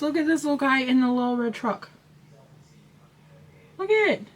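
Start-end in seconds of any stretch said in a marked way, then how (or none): 3.8-4.3 high, gushing squeal over something cute; squealing delight. Real, not acted. Vlogger showing viewers her gnome, high delighted squeal.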